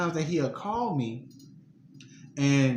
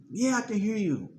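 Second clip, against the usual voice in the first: high answer to the voice; 9 semitones higher than usual. Retold or imitated.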